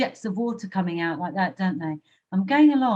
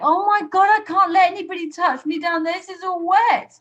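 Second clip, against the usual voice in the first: panicked exclamation; 10 semitones higher, 4 dB louder than usual. Retold or imitated. Her younger self voiced.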